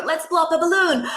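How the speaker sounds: high, excited game voice with a gasp; sing-song invitation to play; playful balloon game. Shown as a demonstration. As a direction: hype up blowing a balloon for a child. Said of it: "So we're gonna go".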